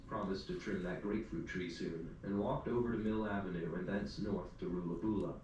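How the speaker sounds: normal talking voice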